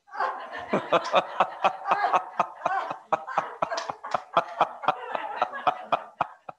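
long, high, breathy laugh at the punchline; own laugh. Real, not acted. Speaker cracking up at the end of her own joke, high and breathy. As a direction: let it all out in a long laugh.

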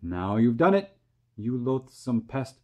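ordinary delivery

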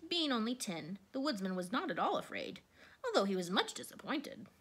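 usual speaking voice

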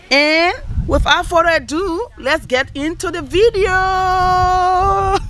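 high, slow showy intro; drawn-out announcer intro. Acted. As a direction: stretch it out like a host.

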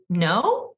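NO rising like a question; an unsure "no" that turns upward; question inflection. Shown as a demonstration. On a date, asked about an ex, questioning rise on "no". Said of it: "accidentally using the question inflection".

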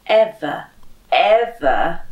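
usual speaking voice

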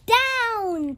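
loud sharp cry of Down; shouted. Acted. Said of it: "cried the witch".

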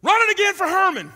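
loud, high shout from above; booming coach command. Retold or imitated. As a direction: yell the order from the tower. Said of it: "Coach Ford from above says".

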